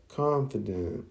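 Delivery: soft, wondering inner voice; acted hushed musing; thinking to herself. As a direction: say it softly, as a private thought. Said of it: "thinks to herself".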